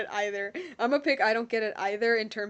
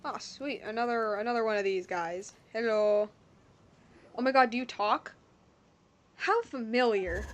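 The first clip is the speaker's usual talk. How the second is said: usual speaking voice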